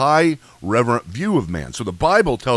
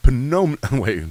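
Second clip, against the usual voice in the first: usual speaking voice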